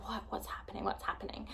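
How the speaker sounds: confused hush among the crew; whisper. Retold or imitated. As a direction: whisper it, puzzled. Confused on the boat, speaking quietly.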